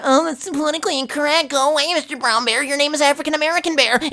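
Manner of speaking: high, mocking goody-goody voice. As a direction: parody a prissy politically correct scold. Mocking a PC person.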